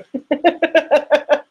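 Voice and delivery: high laughing burst; burst of laughter. Real, not acted. Teacher laughs after fumbling the count. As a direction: laugh at your own counting.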